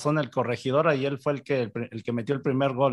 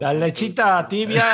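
riendo; 4 semitones higher, slower than usual. Real, not acted. Broma entre risas.

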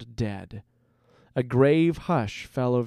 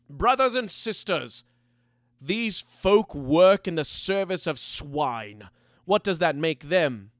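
raised-pitch speech to the band; rallying address; 4 semitones higher than usual. A narrator's reading. Leader turns to his band of outcasts, rallying them. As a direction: put a question to your followers like an orator. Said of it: "loudly offering a question".

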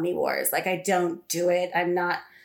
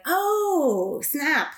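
mock surprise; 6 semitones higher than usual. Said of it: "sort of be like".

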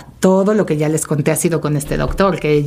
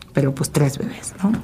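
whispered; 3 semitones lower, 5 dB quieter than usual. Real, not acted. Awed aside about triplets.

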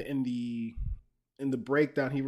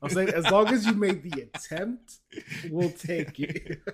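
loud joking denial; 3 semitones higher, 6 dB louder than usual. Real, not acted.

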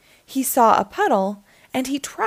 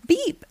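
high little beep; high-pitched toot of a small truck; truck horn beep; 9 semitones higher than usual. A narrator's reading. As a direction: chirp out a short, high beep. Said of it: "said Blue".

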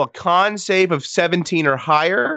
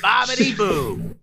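put-on Italian accent; 6 semitones higher, slower than usual. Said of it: "do you wanna say anything in the Italian accent".

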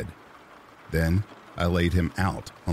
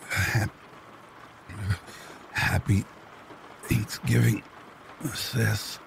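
struggling to speak; 4 semitones higher, 3 dB quieter, slower than usual. A narrator's reading. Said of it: "he struggled to say".

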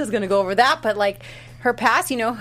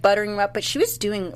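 ordinary talk, nothing marked

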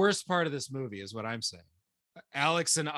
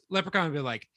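usual speaking voice

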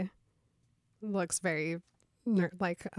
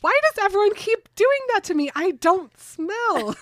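indignant Bella; 13 semitones higher, 7 dB louder than usual. Acted. Bella offended about her smell.

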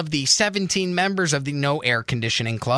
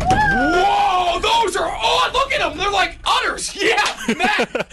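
excited shouting; slower than usual. Real, not acted. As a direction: hoot at the sight, full voice. Host reacts to flashing.

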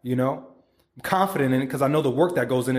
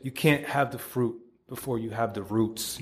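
quieter repeat; 3 semitones lower, 6 dB quieter than usual. Shown as a demonstration. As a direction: let the motto settle gently. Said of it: "Write that shit the fuck down".

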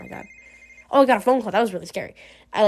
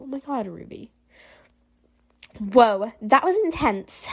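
ordinary delivery

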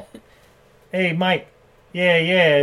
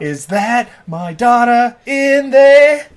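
slow, loud, high mock wail; over-the-top Sean Penn; 9 semitones higher, 10 dB louder, much slower than usual. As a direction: stretch every word in fake anguish. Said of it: "also over the top".

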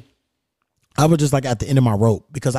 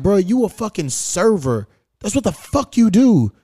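indignant rant; 7 semitones higher than usual. Retold or imitated. Venting at the server who forgot his food, heated retold scolding. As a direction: tell him off for doing his one job wrong.

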